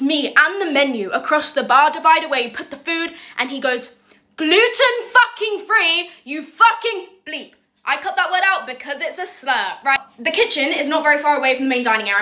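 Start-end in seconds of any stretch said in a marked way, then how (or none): 4.4-7.5 slow, high-pitched mock yell; hammed-up furious chef. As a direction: stretch each word in outraged disbelief. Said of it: "He yelled at me so bad".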